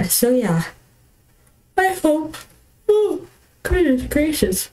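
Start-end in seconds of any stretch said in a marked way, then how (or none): 1.8-4.7 talking through a yawn. Real, not acted. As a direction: yawn mid-sentence. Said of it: "I start yawning".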